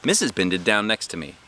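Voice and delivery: ordinary delivery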